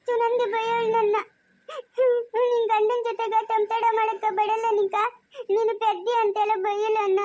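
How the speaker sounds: high wailing tattle; crying complaint. Acted. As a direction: sob out a hurt complaint. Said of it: "ಯಾಕೆ ಅಳ್ತಾ ಇರೋದು ನೀನು". (ಅಳುತ್ತಾ ದೂರು ಹೇಳುವುದು)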